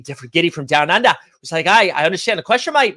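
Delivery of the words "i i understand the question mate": quick, higher, badly faked Australian; hammed-up Aussie accent, rushed and raised; terrible Australian accent. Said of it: "that was a terrible Australian accent".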